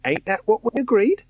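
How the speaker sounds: high, cheeky cockney lad; chirpy ghost friend teasing; cockney ghost boy. A narrator's reading. William the ghost reassuring Roddy, cheeky and high.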